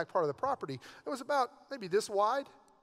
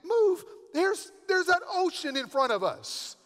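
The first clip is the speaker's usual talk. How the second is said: much higher, louder disbelief; incredulous Moses; 10 semitones higher, 4 dB louder, slower than usual. Retold or imitated. Moses reacting to God's order, high incredulous disbelief. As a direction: balk at an impossible order. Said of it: "Moses is, like, thinking".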